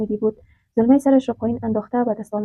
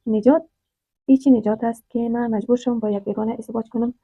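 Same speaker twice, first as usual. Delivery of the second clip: ordinary delivery, nothing marked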